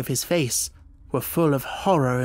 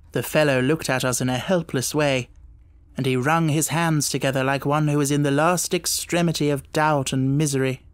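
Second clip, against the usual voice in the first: plain reading voice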